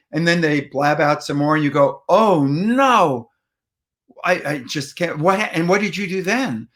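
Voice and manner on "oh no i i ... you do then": encouraging listener. Shown as a demonstration. Listener egging on best friend's story, aghast and engaged. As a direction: hang on your friend's every word. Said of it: "you encourage them".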